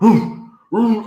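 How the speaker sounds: high, far-off howling like a dog. Retold or imitated. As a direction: howl it out like a hound.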